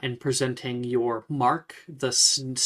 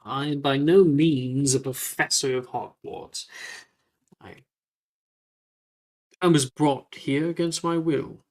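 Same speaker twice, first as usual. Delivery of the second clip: lightly voiced gentleman, emphatic denial; louder, indignant protest in character; protesting ghost; 3 dB louder than usual. Ghost telling student he is no professor, protesting.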